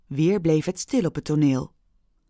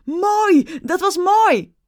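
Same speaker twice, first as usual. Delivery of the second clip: high, loud delighted shout; enthusiastic cry; 11 semitones higher, 5 dB louder, slower than usual. Acted. Another audience member cheers loudly. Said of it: "Een ander riep".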